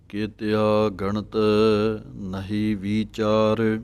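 slow recitation with a pause after ਗਣਤ. Shown as a demonstration. Teacher reciting Gurbani slowly, pause after ਗਣਤ. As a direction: hold a pause on ਗਣਤ, then ਨਹੀਂ ਵਿਚਾਰ. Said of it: "ਗਣਤ ਪਾਠ ਦੇ ਉੱਤੇ ਵਿਸਰਾਮ ਹੈ".

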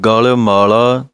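ਗਲ ਮਾਲਾ slow, then stop after ਮਾਲਾ; pause after ਮਾਲਾ. Shown as a demonstration. Teacher showing students the pause, ਗਲ ਮਾਲਾ then rest. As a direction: read ਗਲ ਮਾਲਾ slowly and rest on ਮਾਲਾ. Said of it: "ਮਾਲਾ ਦੇ ਉੱਤੇ ਵਿਸਰਾਮ ਹੈ".

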